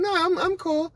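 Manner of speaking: very high sugary reassuring voice. Retold or imitated. Coworker reassures the weed smokers, very high fake-sweet voice. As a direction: fake friendliness before snitching. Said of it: "she's like".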